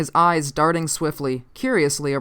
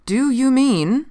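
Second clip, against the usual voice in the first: slow, higher, incredulous; slow question; 9 semitones higher, 5 dB louder, slower than usual. A narrator's reading. Officer trying to understand the stranger, slowly incredulous. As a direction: draw each word out, pitched up. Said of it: "I said slowly".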